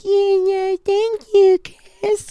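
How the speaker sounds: squeaky voiced little girl thanking Chris. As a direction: chirp it like a sweet child. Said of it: "This little girl goes".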